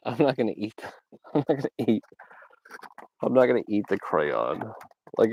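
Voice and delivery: ordinary talk, nothing marked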